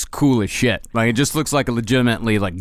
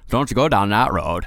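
put-on Fred Gwynne Maine accent; 4 semitones lower than usual. Quoting a Pet Sematary neighbour in an old Maine accent. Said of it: "he will never beat".

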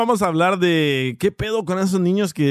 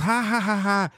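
mock laughter; 6 semitones higher, 3 dB quieter than usual. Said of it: "Y todos".

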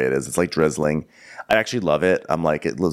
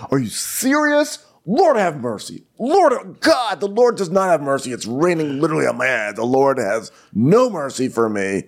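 much higher, dramatic mock lament; mock despairing Austin; 8 semitones higher than usual. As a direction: ham up the woe of getting rained on. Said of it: "And he, and he goes".

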